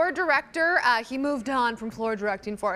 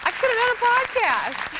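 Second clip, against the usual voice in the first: high, raised mimicry of a complaint; imitated outburst; 11 semitones higher, 5 dB louder than usual. Co-host quoting the comedian's teaser, imitated high outburst. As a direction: quote it as a loud, high-pitched mock outburst. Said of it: "He said".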